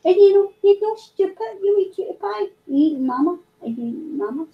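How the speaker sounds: high doting pet voice; singsong cooing. Real, not acted. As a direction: fuss over a beloved pet. Baby-talk to a pet.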